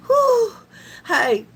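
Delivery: ordinary talk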